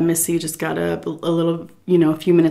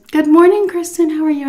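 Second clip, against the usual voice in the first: sing-song greeting pitched much higher; cheery greeting; 10 semitones higher, 5 dB louder than usual. Retold or imitated. Daughter-in-law greeting the caregiver at the door, sing-song. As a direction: imitate an overly bright hostess. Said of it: "She goes".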